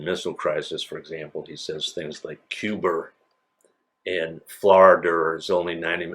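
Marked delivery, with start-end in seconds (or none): 2.5-3.1 "Cuba" ending in an R sound, like "Cuber"; New England intrusive R. Retold or imitated. Imitating President Kennedy on the missile crisis, "Cuba" said as "Cuber". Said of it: "they add an R at the end". 4.5-5.4 "Florida" ending in an R sound, like "Florider"; New England intrusive R. Retold or imitated. Example of New Englanders' added R, "Florida" said as "Florider". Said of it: "they add an R at the end".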